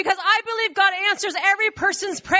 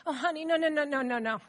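lower, softer string of noes; gentle dismissal; 4 semitones lower, 6 dB quieter than usual. Acted. Believers humour Rhoda, gently dismissive. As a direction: wave it away soothingly.

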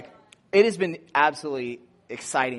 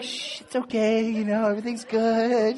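soothing hush; 6 semitones higher than usual. Retold or imitated. Nurse calming the patient.

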